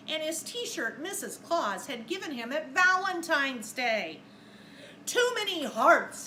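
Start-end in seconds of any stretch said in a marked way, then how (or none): 5.1-6.3 louder, higher Santa grumble; mock-fussy Santa; Santa complaining. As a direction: let Santa fuss at the hearts. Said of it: "he said".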